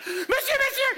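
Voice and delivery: high, loud calls; shrill calling lady. Retold or imitated. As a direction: call out sharply like an impatient client. Client calling him from behind. (cliente qui appelle d'une voix aiguë et forte)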